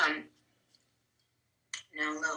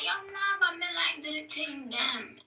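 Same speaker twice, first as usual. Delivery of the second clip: high-pitched, softer put-on Jamaican accent; fake Jamaican accent; 10 semitones higher, 6 dB quieter than usual. Host retells what she said to Lava, fake Jamaican accent. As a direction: quote yourself in high, playful Jamaican patois. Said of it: "fake my Jamaican accent is".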